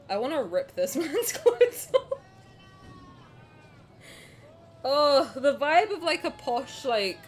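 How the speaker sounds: cracking up over the posh guy; laughing outburst. Real, not acted. Laughing at posh game character.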